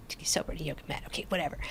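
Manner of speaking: very soft, low, dismissive mumble; grudging mutter. Retold or imitated. Reluctant addict giving in to friend's plan, grudging mutter. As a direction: mumble it under your breath, unconvinced.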